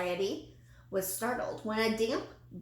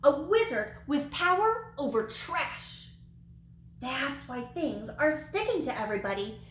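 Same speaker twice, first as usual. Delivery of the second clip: sputtered alarm; 5 semitones higher than usual. Acted. Frightened girl running from the wizard, sputtering in alarm. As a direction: blurt it out in wide-eyed shock. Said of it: "she sputtered".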